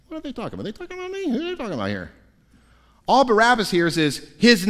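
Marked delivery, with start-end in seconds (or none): 0.1-2.1 anxious muttering. Acted. Barabbas puzzling alone in cell.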